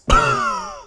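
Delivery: a burst of laughter, way up high; sudden high laugh. Real, not acted. A vlogger bursting into high laughter at lame drone footage.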